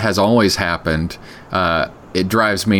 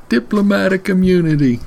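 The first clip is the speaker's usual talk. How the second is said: put-on Lethal Weapon villain's South African accent, higher; 10 semitones higher than usual. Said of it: "South African accent".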